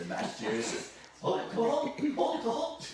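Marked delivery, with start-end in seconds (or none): 1.2-2.9 laughing, much higher, a hammed-up Boston owl hoot; mock Boston hoot. Host joking to viewers that owls hoot in a Boston accent. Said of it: "they don't sound like they're from Boston".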